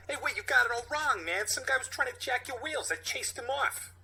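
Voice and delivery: put-on street-kid New York accent, pleading. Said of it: "Do Michael Rappaport".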